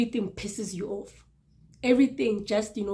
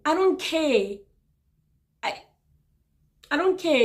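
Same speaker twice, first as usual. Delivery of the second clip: retorted annoyance; 7 semitones higher, 4 dB louder than usual. Retold or imitated. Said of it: "retort to "we love you".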